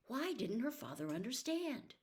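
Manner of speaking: ordinary delivery, nothing marked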